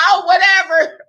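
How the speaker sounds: loud, high, brushing it off; mock dismissive shout. Retorting to the crowd, a loud dismissive shout. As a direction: toss it away with a yell.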